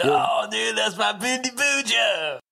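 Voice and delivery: stoner voice. Acted. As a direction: belt it out like a proud stoner. Acted stoner dialogue.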